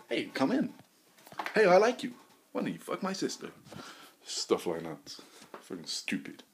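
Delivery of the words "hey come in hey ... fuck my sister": high-pitched mock welcome; put-on high, eager host voice; welcoming householder. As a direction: play a homeowner happily inviting a stranger in. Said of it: "I just walk into people's houses".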